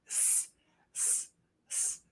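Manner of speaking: "S" as a long hiss. Shown as a demonstration. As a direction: hiss each "s" sharply. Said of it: "emphasize on the S's".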